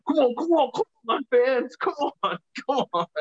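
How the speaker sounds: high-pitched mock crowd hype; rallying the crowd. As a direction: imitate a wrestler egging on fans, pitch way up. Imitates wrestler rallying the crowd.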